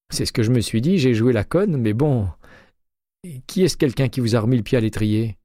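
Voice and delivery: voix de Jay plus aiguë, enjouée; Jay au téléphone. A narrator's reading. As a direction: interlocutrice jouée un ton plus haut, légèrement animée. (Jay, inspectrice, répond à McCaleb au téléphone, voix plus haute)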